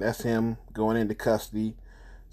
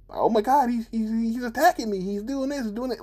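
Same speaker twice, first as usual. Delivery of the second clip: high, frantic fake-victim voice, much higher; shrill mock distress, pitched way up; mock panicked woman; 12 semitones higher than usual. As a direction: ham up a woman crying wolf to the cops. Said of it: "the type of shit they put in comedy sketches".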